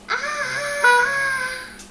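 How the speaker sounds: loud shrill shriek of alarm; high panicked scream. Acted. Elephant screaming when the bird lands on him. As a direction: let out a startled scream.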